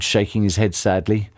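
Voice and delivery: plain reading voice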